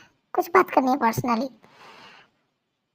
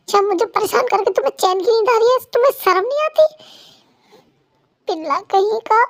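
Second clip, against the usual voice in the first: indignant scolding; 5 semitones higher than usual. Acted. Angry wife scolds sleeping husband.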